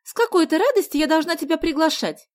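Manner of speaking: high, loud protest; indignant. A narrator's reading. Sonya objecting to inviting a boy, indignant. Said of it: "возмутилась Соня".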